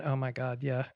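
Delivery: quiet, low, head-shaking exasperation; mock a friend who has heard it all; imitated weary friends. Friends listening to her bonsai talk, imitated weary exasperation. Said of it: "shaking their heads going".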